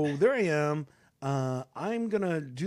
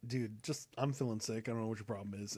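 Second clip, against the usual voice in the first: weary sick wife; 6 semitones lower, 10 dB quieter than usual. Retold or imitated. Tired sick wife on phone.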